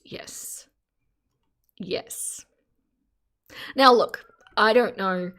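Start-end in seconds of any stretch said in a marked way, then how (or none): 0.0-2.4 whisper. Real, not acted. Whispered agreement.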